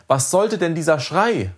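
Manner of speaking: outraged, high-pitched demand; indignant yell. A narrator's reading. Father scolding his son for screaming, indignant high yell. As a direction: demand an explanation, pitched well up. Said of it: "schrie er".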